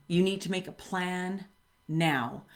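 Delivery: usual speaking voice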